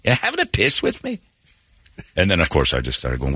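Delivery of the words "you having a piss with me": high, suspicious Scottish challenge; the Glasgow man turning on him; Scottish accent. Retold or imitated. Scottish fan suspicious of the host, in a high Scottish accent. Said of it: "And he's like".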